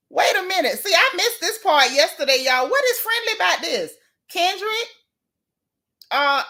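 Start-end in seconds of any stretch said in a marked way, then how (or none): 0.1-4.9 high-pitched, baffled demand; astonished questioning; incredulous. Real, not acted. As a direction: call him out in disbelief, pitched way up. Disbelief, questioning the absent rapper.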